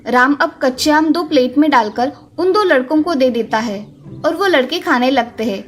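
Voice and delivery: ordinary delivery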